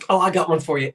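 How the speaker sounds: normal talking voice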